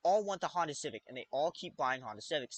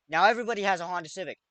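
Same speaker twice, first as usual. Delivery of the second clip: fast excited rush; wild unhinged ranting; 3 semitones higher, 7 dB louder, faster than usual. Acted. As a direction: blurt it out quickly. Absurd rant escalating.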